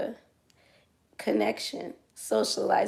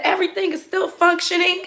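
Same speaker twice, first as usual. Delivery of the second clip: laughing; 10 semitones higher, 5 dB louder than usual. Retold or imitated. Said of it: "He said".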